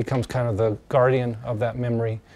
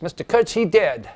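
high, raised announcement; announcing a death; 6 semitones higher than usual. Acted. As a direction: call out the death news in a high voice. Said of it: "Mister Kurtz dies".

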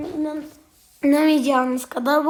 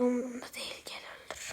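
softly whispered surrender; whispered, defeated dare; resigned whisper; 4 semitones lower, 20 dB quieter, faster than usual. Real, not acted. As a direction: let the words drop to a whisper. Resigned giving up in a fight.

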